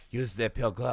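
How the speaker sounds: corrected French-accented take. Shown as a demonstration. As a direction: say "Use the pilgrim" with a French lilt, quietly. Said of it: "it's a little more".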